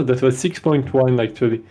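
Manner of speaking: normal talking voice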